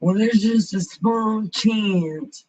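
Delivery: slow, put-on doctor impersonation; mock doctor. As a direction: imitate a doctor hedging, drawn out. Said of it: "I hate when doctors do that".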